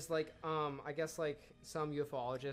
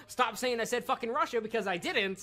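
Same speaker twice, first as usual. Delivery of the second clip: raised, higher exasperated complaint; exasperated protest; 9 semitones higher, 7 dB louder than usual. Real, not acted. To friends teasing her about Russia, loud exasperated protest. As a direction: stop teasing me, fed up and loud. Annoyed at being teased.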